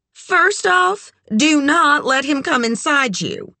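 high, emphatic friend's warning; brassy advice-giver. A narrator's reading. Red warns Kit over the phone, brassy and emphatic. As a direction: lay down the rule, pitched high.

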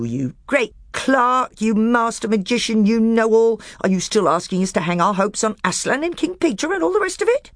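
high, loud, heaping insults; scornful dwarf. A narrator's reading. Nickabrick mocking the magician in open anger. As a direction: jab each name at him.

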